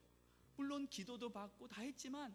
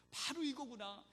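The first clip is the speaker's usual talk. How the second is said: higher, louder voicing of a joyful discovery; retold elated realization; 5 semitones higher, 6 dB louder than usual. Church member suddenly grasps the sermon's meaning, retold with joy. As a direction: voice her sudden realization with mild elation, pushed up and out. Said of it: "놀라운 희열과 같은 은혜를 느끼게 되고요".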